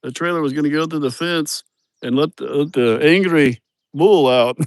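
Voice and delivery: light mock Mexican accent; retelling the worker's fear of the bull in a Mexican accent. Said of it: "And he goes".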